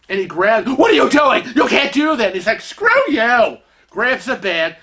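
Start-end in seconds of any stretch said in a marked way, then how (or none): 0.7-2.3 loud high shout of alarm; guard shouting. Retold or imitated. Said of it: "starts yelling at him". 2.6-3.6 defiant retort. Retold or imitated. Kevin defying the guard.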